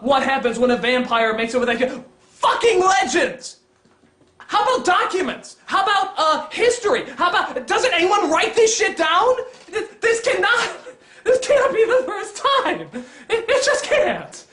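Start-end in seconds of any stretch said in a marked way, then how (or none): 2.2-3.6 higher, drawn-out incredulous cry; ranting in exasperation; exasperated outburst. Real, not acted. Exasperated rant about the plot. 4.4-14.5 high-pitched, incredulous rant; exasperated disbelief. Real, not acted. Exasperated rant about the plot.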